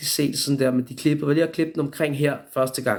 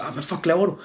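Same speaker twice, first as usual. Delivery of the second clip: higher-pitched startled protest; mock indignant yelp; retold pained outcry; 5 semitones higher than usual. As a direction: react to sudden pain, mildly acted. Retold reaction to sudden pain.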